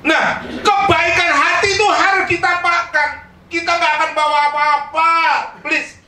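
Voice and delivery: rally the congregation; exhorting shout. Real, not acted. Preacher raises voice to exhort. (berseru keras kepada jemaat)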